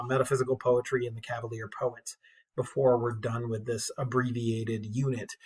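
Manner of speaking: ordinary talk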